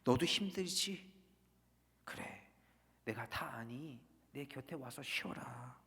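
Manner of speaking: quiet, tender consolation; God's soft whisper. Retold or imitated. God comforting the wounded Jonah, hushed and tender. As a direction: comfort a hurt child softly. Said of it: "하나님의 이런 속삭임이에요".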